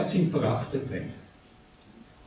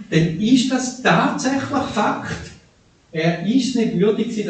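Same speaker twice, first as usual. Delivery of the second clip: ordinary talk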